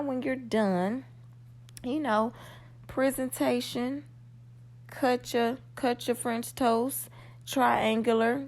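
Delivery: normal talking voice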